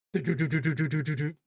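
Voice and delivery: rapid mouth-made gunfire bursts; imitated machine-gun fire. Recapping the comic, a machine gun sprays Sally. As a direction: rattle it off like a cartoon gun.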